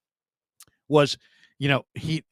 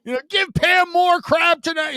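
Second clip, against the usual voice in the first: high, loud hollering at a passing celebrity; fans yelling from cars; 5 dB louder, slower than usual. Retold or imitated. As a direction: shout up high like a fan from a car window. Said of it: "They're beeping".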